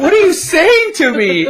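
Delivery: baffled outburst. Retold or imitated. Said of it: "I was like".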